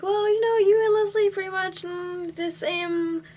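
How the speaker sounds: high-pitched, acted-out backpedalling; awkward hedging. Retold awkward backpedalling to actress.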